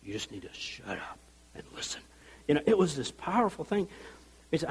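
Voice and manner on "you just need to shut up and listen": low, much softer whisper; tough whispered warning. Retold or imitated. Angel telling Zach to listen, whispered low. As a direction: hiss it like a quiet threat. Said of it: "this moment like".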